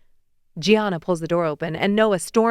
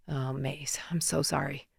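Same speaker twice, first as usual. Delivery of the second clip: hushed, much softer comfort; tender whisper; 4 semitones lower, 11 dB quieter than usual. Acted. Noah comforting a crying Macy, tender whisper. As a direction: hold her and whisper it gently.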